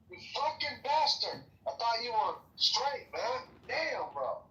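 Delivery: hammed-up indignant cursing at a buddy; swearing tirade. Mock outrage at friend's theft.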